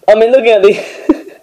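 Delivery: laughing through the words, pitch jumping up; real laugh bubbling out. Real, not acted. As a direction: giggle while you talk. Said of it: "I don't know why I'm just laughing here".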